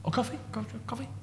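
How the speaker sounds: soft, high, near whisper; lightly voiced host, hushed; hushed query. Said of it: "He goes".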